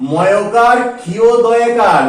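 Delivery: ordinary talk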